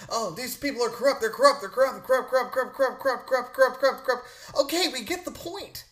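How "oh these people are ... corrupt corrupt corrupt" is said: rapid-fire repeated word, raised pitch; mock droning pundit. Host mocking pundits harping on corruption, repetitive chant. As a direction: imitate a pundit stuck on one word like a broken record. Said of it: "You listen to, um, uh, Car-, Tucker Carlson".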